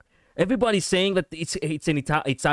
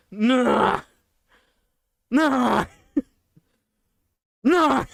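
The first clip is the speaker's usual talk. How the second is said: mock despair; 7 semitones higher, much slower than usual. Mock despair once Greek is confirmed.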